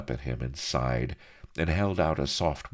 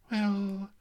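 a touch dreamy, starting high; slower than usual. Acted. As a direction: muse on it dreamily. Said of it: "smiled Mistress Bonny dreamily".

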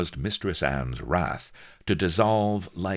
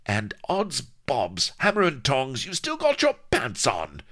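high, loud pirate outrage; pirate wench fury; 11 semitones higher, 4 dB louder than usual. A narrator's reading. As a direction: erupt like a furious pirate wench. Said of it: "it was the pirate wench spitting blood".

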